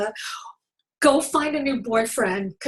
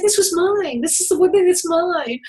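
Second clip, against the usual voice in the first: upset; 6 semitones higher, 5 dB louder than usual. Retold or imitated. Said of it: "I was really upset".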